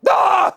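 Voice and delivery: excited yell. Acted. Excited sponsor intro yell.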